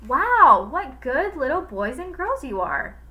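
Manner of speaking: a hint of a smug villain teacher; sweetly mocking praise, lightly voiced; sly teacher. A narrator's reading. Teacher gloating over the silent class, lightly voiced. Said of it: "said Mister Gorf".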